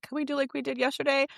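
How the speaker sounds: put-on high childlike asking voice; child's voice. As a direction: mimic a little boy's eager question. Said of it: "And he's like".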